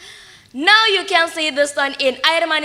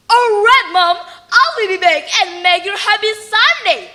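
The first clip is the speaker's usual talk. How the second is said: higher and louder, eager and bright with joy; happily; 5 semitones higher, 4 dB louder than usual. Acted. Son promising his mother he will return, joyfully eager. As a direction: burst out with glad promise. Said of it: "Malin answered happily".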